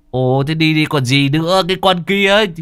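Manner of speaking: high, loud mocking old man; high scolding shaman voice; Cậu Sáu scolding ghost. A narrator's reading. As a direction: shoo the lingering ghost off, half teasing. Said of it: "Cậu bảo".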